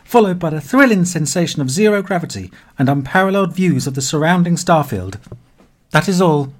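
cheerful PA announcer voice; airport announcement. Acted. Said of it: "the familiar noise of the, and the A I".

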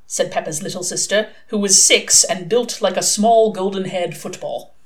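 ordinary delivery, nothing marked

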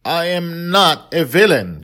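put-on girl screaming at her father that she is not a villain; yell. A narrator's reading. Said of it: "Dragon Little yelled".

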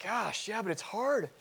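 high-pitched, put-upon complaint; mock exasperation, pitched well up; exasperated protest. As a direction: act out a reluctant wife's whine. Voices a wife's reluctant reply.

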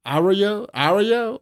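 Arroyo as a high, mocking question, AR-royo; incredulous high "Arroyo?", first syllable stressed; accent on first syllable. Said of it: "put the accent on the first syllable".

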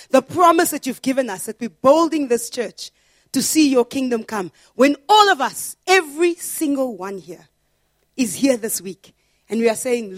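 rally the church to stand together; full-force preaching. Acted. Pastor rallying the congregation to pray together, preaching at full force.

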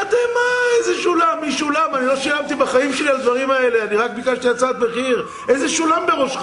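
high, loud, incredulous tirade; retold furious outburst. Customer to supplier over bill supposedly paid, shouting furiously. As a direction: explode in acted disbelief and rage.

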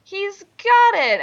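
high, slow triumphant cry; excited exclaim. Acted. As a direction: proclaim it like a big win.

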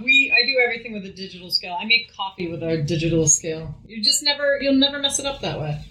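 ordinary delivery

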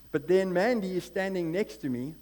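rising sermon fervour from the pulpit; fired-up preaching. Acted. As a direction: preach the story at full force.

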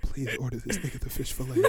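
soft, low mock plea; quiet plea. Bystander silently begs girl to order, hushed plea. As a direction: beg under your breath, half amused.

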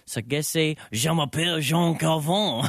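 hammed-up French accent, slow at the start; John Calvin French accent. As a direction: play a mock French reformer in a French accent. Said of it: "That was my John Calvin impersonation".

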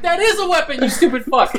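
very high mock outrage; imitated exasperated delivery. Villain scolding guards about the staff, mock exasperated. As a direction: scold an idiot in squeaky disbelief. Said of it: "It's like how he delivered that line".

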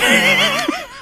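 high breathy laugh bursting out; burst of laughter. Real, not acted. Fan laughing at the kid's reaction, high laugh. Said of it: "I just started laughing".

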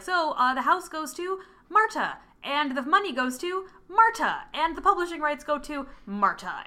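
hammed-up lawyer reading out the will; announcing each bequest with mock pomp; mock lawyer announcement.

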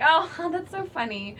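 high, slow, sugary fake amusement; fake polite laugh. Wife at dinner playing along, falsely amused and slow. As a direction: laugh it off sweetly while seething. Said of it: "and then I'd be like".

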